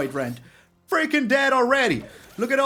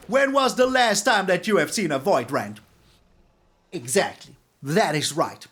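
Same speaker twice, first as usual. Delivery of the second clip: usual speaking voice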